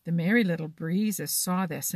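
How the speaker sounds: usual speaking voice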